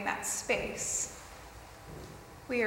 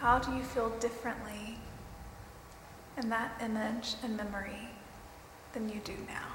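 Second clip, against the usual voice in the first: guided meditation; 3 dB quieter than usual. Acted.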